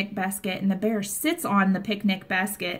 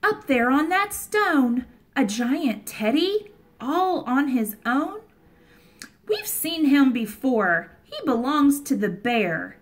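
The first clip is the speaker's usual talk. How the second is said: storybook wonder; 2 semitones higher than usual. Acted. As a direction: gasp at the sight for the children. Reads story to children.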